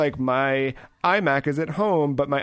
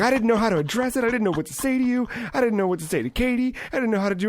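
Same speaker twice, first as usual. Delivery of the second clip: flustered mom; 8 semitones higher, faster than usual. Retold or imitated. Mom retelling her worry.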